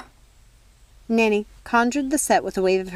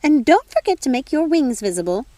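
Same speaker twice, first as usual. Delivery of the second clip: high, louder, eager squeal; squealing fairy godmother; 6 semitones higher, 5 dB louder than usual. Acted. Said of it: "the fairy godmother squealed".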